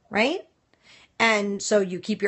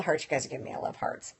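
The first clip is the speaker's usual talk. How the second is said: whispered aside; 8 semitones lower, 9 dB quieter, faster than usual. Real, not acted. Aside while viewers send hearts.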